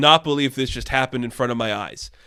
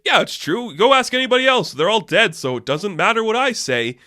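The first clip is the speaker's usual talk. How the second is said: mock boastful producer; 7 semitones higher, 3 dB louder than usual. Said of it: "He's like".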